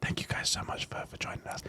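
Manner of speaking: breathy whispered thanks; whispering. Real, not acted. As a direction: whisper a goodbye. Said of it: "You started whispering".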